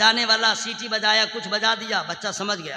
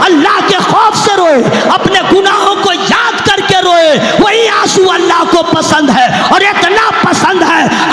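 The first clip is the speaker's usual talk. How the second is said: much louder, higher, slow impassioned sermon; full-force preaching; 6 semitones higher, 12 dB louder than usual. Acted. Preacher cries out to the congregation at full force. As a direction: cry it out to the gathering.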